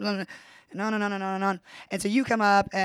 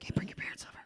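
whispered aside, much softer; mock whisper; 14 dB quieter, faster than usual. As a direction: whisper it like a quick word to the side. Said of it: "and he's like".

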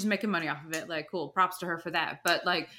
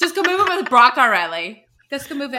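loud tease; 5 semitones higher, 11 dB louder than usual. Real, not acted. Teasing friend loudly.

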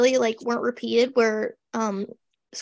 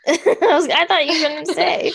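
real laughter through the words; laughing; 5 semitones higher, 6 dB louder than usual. Real, not acted. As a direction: say it laughing, louder and higher. Amused by co-host's admission.

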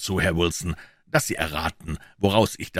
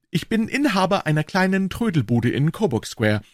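higher-pitched eager pawnbroker; Wilson's voice; 8 semitones higher, 3 dB louder than usual. A narrator's reading. Wilson, the pawnbroker, answering Holmes, in a higher voice. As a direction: pitch him up as the earnest tradesman.

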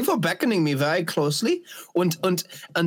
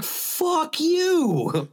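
mock fuck you; 10 semitones higher than usual. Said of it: "I'm just like".

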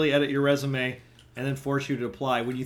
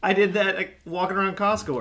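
loud amused scoff; 7 semitones higher, 4 dB louder than usual. Real, not acted. Mocking a thousand steps.

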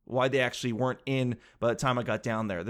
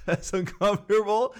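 higher-pitched, laughing through the words; 6 semitones higher, 3 dB louder than usual. Real, not acted. Teller imagines making everyone uncomfortable, laughing. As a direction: repeat it while cracking up.

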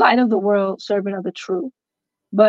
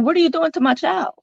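high, alarmed demand to him; alarmed cry; 8 semitones higher than usual. Retold or imitated. Mother confronting the man hurting her son, high and alarmed.

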